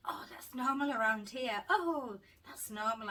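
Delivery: breezy, offhand doctor, slow and unbothered; shrugging dismissive reassurance; blasé. Retold or imitated. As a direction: toss it off like it's nothing. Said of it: "very, like, blasé".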